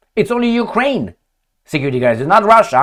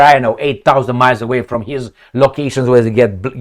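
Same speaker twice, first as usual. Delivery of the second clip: ordinary talk, nothing marked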